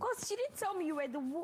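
snapping back loudly; loud sharp voice. Real, not acted. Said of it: "Why are you shouting at?".

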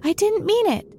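put-on high-pitched girlish plea; pleading. A narrator's reading. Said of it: "pleaded poor Alice".

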